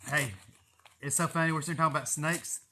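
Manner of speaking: normal talking voice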